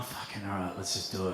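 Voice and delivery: low, very soft aside; hushed agreement. Acted. One friend to another before disturbing strangers, hushed and low. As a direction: murmur it low to your mate.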